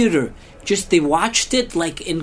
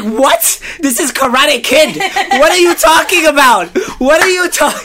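much higher and louder, slowed, incredulous; outraged disbelief; 8 semitones higher, 7 dB louder than usual. Retold or imitated. Reacting to the Kung Fu line in the theater, outraged and high. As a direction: protest like you've been cheated at the movies. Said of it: "I was like".

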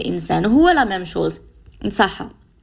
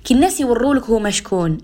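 usual speaking voice

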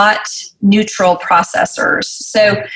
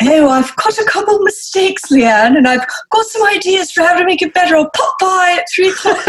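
much higher, put-on documentary narrator voice; David Attenborough; 10 semitones higher than usual. Liked colleague's email read by nature narrator, hammed-up impression. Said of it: "David Attenborough on those Planet Earth documentaries".

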